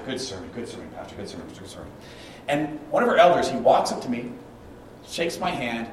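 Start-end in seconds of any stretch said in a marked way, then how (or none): 0.1-1.9 soft, low, repeated compliments fading away; churchgoers' murmured praise. Retold or imitated. Congregation to pastor after church, murmured praise. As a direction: imitate a handshake line, trailing off.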